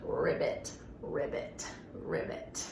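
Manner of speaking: low fading whispered ribbit; soft low frog croak. Shown as a demonstration. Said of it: "Let's make that froggy sound".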